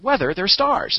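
high-pitched, incredulous objection; retold disbelief. Acting out own startled thought.

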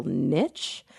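short i and a "tch" ending on niche; niche as "nitch". Real, not acted. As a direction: say niche to rhyme with rich. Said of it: "I pronounce it niche".

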